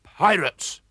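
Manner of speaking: sharp, alarmed cry. A narrator's reading. Old man cries out warning at pirates, alarmed. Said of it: "cried Mister Oldcastle".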